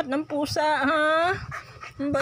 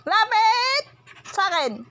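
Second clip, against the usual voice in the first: higher, louder repeat of the command; louder calling; 8 semitones higher, 3 dB louder than usual. Real, not acted. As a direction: call the dog again, harder. Calling the dog.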